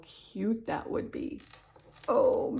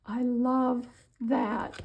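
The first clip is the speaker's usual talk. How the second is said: very slow whisper, higher and louder; long swooning whisper, higher up; drawn-out whisper; 5 semitones higher, 5 dB louder, much slower than usual. Real, not acted. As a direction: stretch the whisper out, gushing. Repeats it, more intensely.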